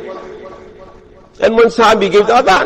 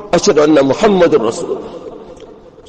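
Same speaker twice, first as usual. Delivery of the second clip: normal talking voice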